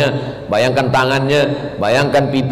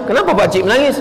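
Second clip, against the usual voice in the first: ustaz asking; 8 semitones higher, faster than usual. Retold or imitated. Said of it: "Ustaz kawan saya tanya".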